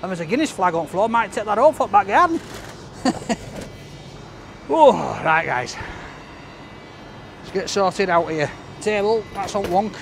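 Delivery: ordinary talk, nothing marked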